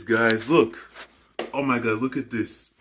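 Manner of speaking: ordinary delivery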